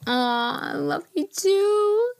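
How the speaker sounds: high, slow sing-song reply; sweet reply. Pretend. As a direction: coo it back sweetly. Sweet reply to listener message.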